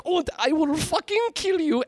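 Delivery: high-pitched mock-villain threat; hammy Nazi villain. Retelling the Nazi's threat, hammed-up. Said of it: "he's like".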